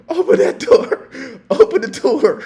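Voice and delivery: high, breathy laugh-through command; laughing order. Retold or imitated. Officer to drunk on the floor, wheezing with laughter. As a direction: gasp it out between laughs.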